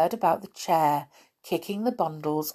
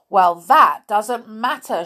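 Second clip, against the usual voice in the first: loud, raised retort; angry shout; 4 semitones higher, 8 dB louder than usual. A narrator's reading. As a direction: shout it back, cross. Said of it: "shouted the little folk".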